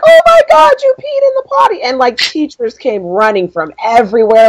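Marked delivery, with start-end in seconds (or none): 0.0-1.8 very high excited squeal; potty training praise. Retold or imitated. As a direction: shriek with joy over the potty win. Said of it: "I just screamed".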